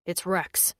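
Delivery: understated somber male character; somber alien man. A narrator's reading. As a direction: break bad news quietly and low. Daz gives somber news.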